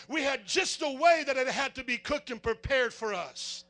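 forceful, rolling preacher delivery; full-force preaching. Real, not acted. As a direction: hammer each step home to the congregation.

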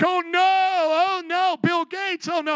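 high, loud, slow mock wail; mock wailing Oprah. Preacher mocking Oprah wailing at judgment, high and slow.

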